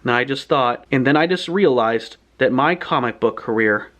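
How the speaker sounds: usual speaking voice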